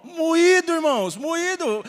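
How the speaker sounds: loud, high anguished exclamation; heartfelt cry. Real, not acted. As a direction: cry out how crushed you felt inside. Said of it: "E aqui dentro como?".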